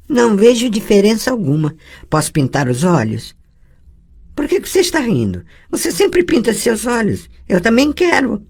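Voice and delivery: put-on a girl begging to paint her eyes too; pleading young girl.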